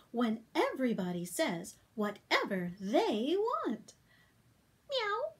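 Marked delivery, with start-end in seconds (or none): 4.9-5.3 squeaky high meow; bright little kitty; high cat meow. Acted. The cats meow in a high voice.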